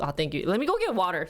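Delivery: ordinary talk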